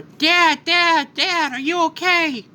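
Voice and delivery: put-on a kid calling out to his dad in alarm; Gohan. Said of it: "Gohan runs over".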